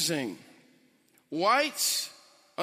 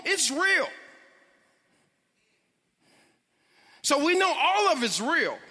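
preaching; 6 semitones higher than usual. Acted. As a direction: preach it with rising passion. Preaching builds to full force.

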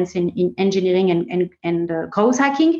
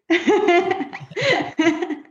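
high burst of laughter; laughing; 8 semitones higher than usual. Real, not acted. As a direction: laugh at your own joke. Laughs at own joke.

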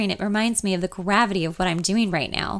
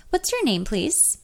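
higher, squeaking receptionist question; high squeaky voice; 4 semitones higher than usual. Acted. Receptionist asks the caller's name, high and squeaky. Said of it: "her high nasal voice squeaking".

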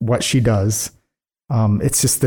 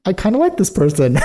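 much higher, delighted turn; mock surprised liking; 13 semitones higher than usual. Fan admitting she likes the villain, much higher and delighted. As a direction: sound pleasantly caught off guard. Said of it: "I'm like".

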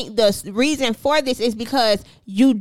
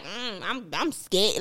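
mock timid; 4 semitones lower, 9 dB quieter, slower than usual. Acting out a quiet scared reaction.

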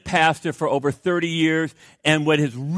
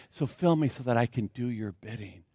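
quiet prayer; 7 semitones lower, 9 dB quieter than usual. Acted.

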